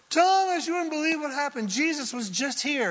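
high, fast breathless news; excited disciples. Retold or imitated. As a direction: burst in with the wild news. Excited disciples tell Thomas.

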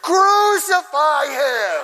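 high, very drawn-out yell; imitated over-the-top shout; bloodthirsty crowd cry. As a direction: bellow it like a mob. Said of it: "one time I heard".

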